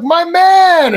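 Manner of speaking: high, drawn-out fake recognition; feigned buddy greeting. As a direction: echo the hail, pretending to know him. Acts out faking recognition back.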